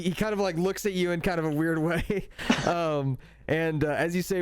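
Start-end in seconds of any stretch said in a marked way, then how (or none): none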